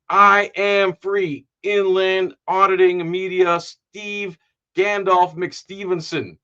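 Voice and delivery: loud, high, drawn-out billing; big announcer. Real, not acted. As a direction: announce the guests slowly and grandly. Announcing next week's guests.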